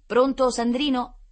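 loud, high, drawn-out call; calling down the line. A narrator's reading. Lucrezia checking her brother is on the phone, calling loudly. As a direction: call slowly to get attention.

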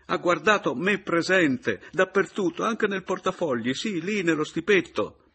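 high, loud, insistent outburst; protest, unable to contain it; agitated protest. A narrator's reading. Adriana contradicting the narrator's story, agitated. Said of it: "non poté reggere".

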